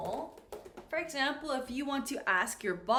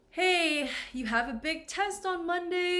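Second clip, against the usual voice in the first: higher-pitched call to a sister; advising a sister; 6 semitones higher, 4 dB louder than usual. Acted. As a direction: call out "Hey" up high, urging a sibling. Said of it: "I tell my sister".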